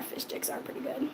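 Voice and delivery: soft unvoiced whisper. Real, not acted. Vlogger whispering her view on fish sticks to chat. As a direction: say it under your breath. Quiet repeat of opinion.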